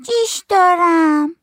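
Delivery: very high, long-drawn kiddie announcement; loud little kid, words stretched right out; small child's voice. Acted. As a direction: call it out slowly in a squeaky child voice. Child announces need to pee.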